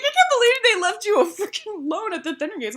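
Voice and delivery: high, fast mock indignation; outraged mom. Mom on learning the group was left alone, high-pitched indignation. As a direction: imitate a mother rattling off her disbelief.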